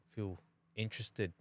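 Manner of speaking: usual speaking voice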